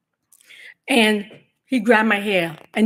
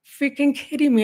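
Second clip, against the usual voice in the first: incredulous; 6 semitones higher than usual. Retold or imitated. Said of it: "I'm thinking".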